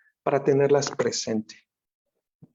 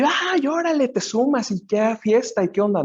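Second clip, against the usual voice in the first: much higher, louder party cheer; mock partying excitement; 9 semitones higher, 5 dB louder than usual. Teacher mocks getting swept up in joy, high excited cheer. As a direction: play someone jumping into the fun. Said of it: "o ves el gozo, el contento".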